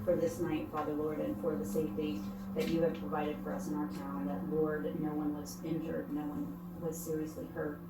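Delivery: soft, earnest praying voice; prayer. Acted. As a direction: pray quietly and sincerely. Leads opening prayer at meeting.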